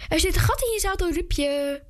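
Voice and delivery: high, quick teasing call. A narrator's reading. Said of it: "riep een meisje met de krullen".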